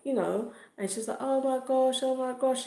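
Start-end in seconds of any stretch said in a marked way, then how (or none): 1.2-2.6 high-pitched mock distress; high, upset put-on voice; imitating crying victim. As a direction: mimic a tearful wail without real crying. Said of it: "And she was crying, crying, crying".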